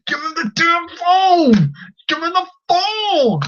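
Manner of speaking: high, drawn-out exasperated demand; worked-up pleading anger; pissed off. Acted. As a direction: stretch it out high, as if yelling at the screen. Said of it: "That little kid pissed me off".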